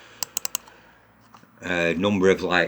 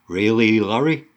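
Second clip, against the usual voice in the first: sarcastic disbelief; 3 semitones higher, 4 dB louder than usual. Real, not acted. Sarcastic challenge to Larry.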